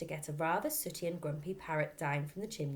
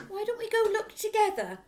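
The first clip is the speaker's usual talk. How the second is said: nervous father; 9 dB louder than usual. Acted.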